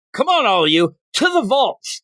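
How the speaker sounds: high-pitched mock-heroic order; rally the henchmen, pitch climbing; rallying command. Peter acts out rallying the minions.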